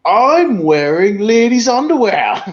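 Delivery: high, drawn-out comic boast; silly sketch voice. Retold or imitated. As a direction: proudly announce it like a clown. Retelling Hammond's recurring line.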